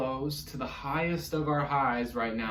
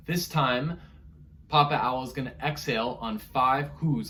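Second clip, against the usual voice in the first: ordinary talk, nothing marked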